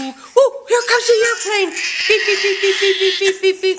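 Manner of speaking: playful high sing-song beeping; airplane spoon game. Pretend. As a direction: zoom the spoon in like a plane, beeping. Airplane spoon feeding the child.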